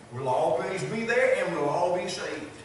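normal talking voice

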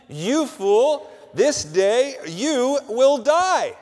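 God's judgment. Retold or imitated. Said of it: "God said unto the man".

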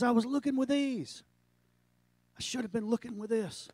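high, fading confession; emotional confession. Retold or imitated. Pastor confessing his blindness, high then fading.

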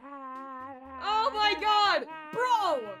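high, loud, drawn-out shouting; real overwhelmed excitement, stretched words; ecstatic screamed thanks. Real, not acted. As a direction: yell in astonished gratitude.

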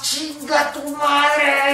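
a high, stretched-out curse, slow and drawn; cursing while running. Shown as a demonstration. Coach shows listeners what to yell while running, stretched out slowly. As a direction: vent it mid-jog, slow and high. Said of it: "mientras vas corriendo vas".

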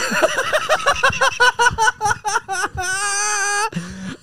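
helpless, high-pitched laughing fit; long high laugh. Real, not acted. Co-host cracks up at her own story, laughing hard.

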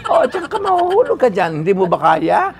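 high, loud, daring him to do it; challenging shout. Retold or imitated. Director daring the actor to take the fall, loud and high. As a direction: push him like an impatient director.

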